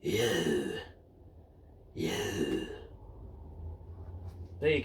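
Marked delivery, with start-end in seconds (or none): 0.0-0.7 deep gruff London hardman, much lower; Phil Mitchell impression. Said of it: "in a Phil Mitchell impression". 1.9-2.7 deep gruff London hardman, much lower; Phil Mitchell impression. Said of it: "Did it sound like Phil Mitchell?".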